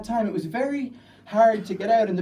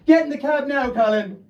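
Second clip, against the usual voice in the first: loud, high urgent yell; urgent shout across the street; 8 semitones higher, 7 dB louder than usual. Retold or imitated. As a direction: order him into the cab at full volume. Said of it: "I shout in English at Colin".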